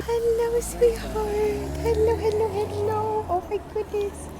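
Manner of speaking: very high cooing pet voice. Real, not acted. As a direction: coo sweetly at the bird, way up high. Cooing at a bird.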